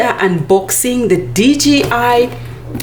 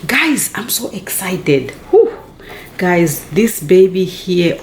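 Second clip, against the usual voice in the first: normal talking voice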